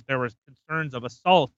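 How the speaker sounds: ordinary delivery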